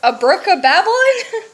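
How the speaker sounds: high, loud, laughing mock echo. Echoing her cohost's words back, laughing. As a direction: tease the phrase back through a laugh.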